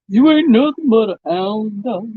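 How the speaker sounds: half-sung impression of a famous rock-and-roll singer. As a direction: do your best singer impression, playfully. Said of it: "That's my best [name] impression".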